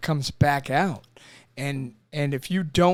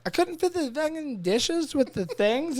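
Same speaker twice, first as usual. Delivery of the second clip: mock frustrated wife; 9 semitones higher than usual. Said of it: "your wife's like".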